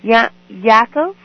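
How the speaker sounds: loud and slow into the phone; to someone hard of hearing. Real, not acted. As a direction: spell it out loudly for a bad line. You have to speak up.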